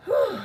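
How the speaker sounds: breathless whew; panting exhale. Real, not acted. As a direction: puff out a tired breath. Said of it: "I don't know why I'm panting".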